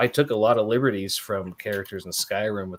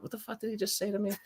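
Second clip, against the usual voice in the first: muttered confusion; 9 semitones higher, 12 dB quieter, much faster than usual. Retold or imitated. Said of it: "going like".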